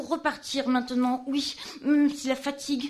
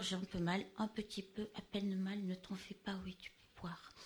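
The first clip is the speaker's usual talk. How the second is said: softer and lower down to a whisper; fading, admitting pain; 4 semitones lower, 12 dB quieter than usual. Acted. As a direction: let the voice die away as the pain wins.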